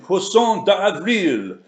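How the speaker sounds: put-on slow, drawn-out French accent. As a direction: say it like a proud Frenchman, slowly. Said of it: "my French accent".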